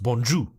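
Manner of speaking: 'bonjour' as bon-joo, R dropped; silent final R. Shown as a demonstration. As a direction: end 'bonjour' on an oo with no R. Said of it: "they taught us it was pronounced".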